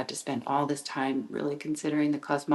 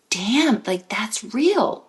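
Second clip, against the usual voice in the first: amazed reader; 6 semitones higher, 7 dB louder, much slower than usual. Pretend.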